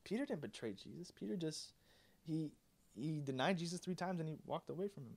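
softly voiced doubter, questioning lift on the name; quietly object, puzzled, hesitating; mild, hushed disbelief; puzzled objection. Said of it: "Now you might be thinking".

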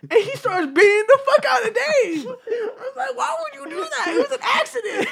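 high laughing mock protest. Real, not acted. Teller laughs acting his plea to his friend. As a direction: giggle out the plea. Laughing about horse being beaten.

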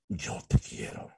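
low, soft whisper to a partner; whispered declaration. Shown as a demonstration. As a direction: whisper it intimately. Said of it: "la forma como decimos las cosas". (te quiero susurrado)

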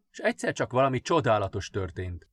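plain reading voice

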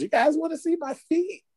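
high, teasing mock girl voice; high girly voice. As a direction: offer it up sweetly, pitched high. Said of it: "Showing feet in racial chat rooms".

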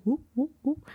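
playful high-pitched whoop noises. Retold or imitated. Host joking about her goldfish attention span, playful high whoops.

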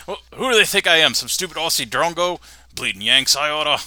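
muttering Aussie grump. Acted. Bruno grumbles while waiting.

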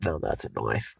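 very deep, hushed mock Aussie; Australian accent. Repeating the line for laughs, deep quiet Australian accent. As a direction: drop low and soft, pretend Australian. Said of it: "talk in an Australian accent".

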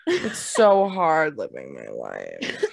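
deep slow dramatic sigh; hammed-up self-pity; low mock lament.

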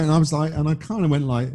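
ordinary talk, nothing marked

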